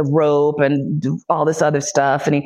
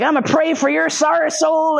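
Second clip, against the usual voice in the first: high, hammed-up preaching threat; mock preacher; 10 semitones higher than usual. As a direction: imitate a zealot praying over a sinner. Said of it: "he's, like, reciting passages from the Bible".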